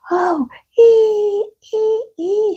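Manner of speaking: very high shrieking. Acted. Mother shrieking at a mouse, very high. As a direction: shriek in fright. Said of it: "shrieked the mother".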